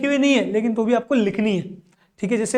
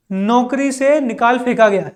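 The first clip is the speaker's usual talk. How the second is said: ordinary talk, nothing marked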